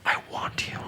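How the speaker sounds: soft breathy whisper. Retold or imitated. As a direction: whisper it close. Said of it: "she whispers, she goes".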